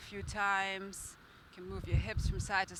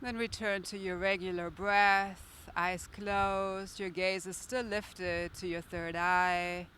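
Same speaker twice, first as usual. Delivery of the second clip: calm, steady guiding voice; guided meditation. Acted. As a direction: guide the breathing gently. Leads a guided breath meditation.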